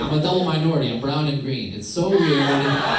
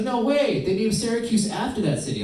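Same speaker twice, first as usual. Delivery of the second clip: much higher, excited disbelief; mock amazement; 10 semitones higher than usual. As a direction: gush like an eager fan. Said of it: "I was like".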